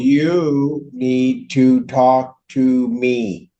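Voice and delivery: very slow, deliberate reprimand; measured judicial rebuke, drawn right out; firm correction. Real, not acted. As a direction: spell it out slowly, word by word. Judge corrects a litigant addressing opponent.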